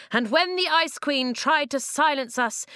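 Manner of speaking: rally the tribes, voice up high; rousing speech. Acted.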